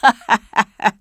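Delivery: staccato ha-ha cackle; old rogue's laugh. A narrator's reading. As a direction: laugh at your own joke in character. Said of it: "This seemed to be a joke".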